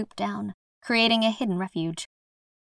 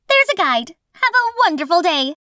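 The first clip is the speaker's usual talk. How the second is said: chirpy guide voice; 10 semitones higher, 5 dB louder than usual. Acted. Guide says goodbye in character.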